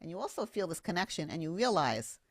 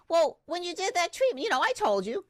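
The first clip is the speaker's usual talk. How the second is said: client's casual reply; 11 semitones higher, 6 dB louder than usual. Retold or imitated. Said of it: "she goes".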